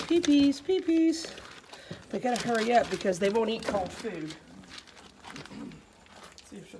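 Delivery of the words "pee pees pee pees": high, louder sing-out; puppy coaxing. Real, not acted. Urging puppies to pee.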